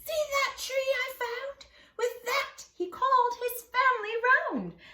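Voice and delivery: high, loud eager call; excited rabbit. Acted. Benjamin Rabbit proudly calls his family round, high and loud. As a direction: show it off with glee. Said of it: "he cried".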